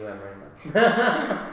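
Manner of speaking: high, drawn-out teasing name; teasing call-out. Real, not acted. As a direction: drag the name out playfully.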